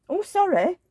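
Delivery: ordinary delivery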